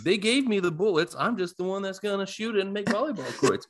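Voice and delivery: high-pitched pleading voice, close to laughing; mock self-justifying excuse. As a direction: plead your case jokingly in a high voice. Playing Favre's excuse, near laughter.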